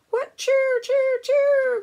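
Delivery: high, loud birdsong cry; cardinal call. Acted. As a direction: sing out like a cardinal. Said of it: "goes the cardinal".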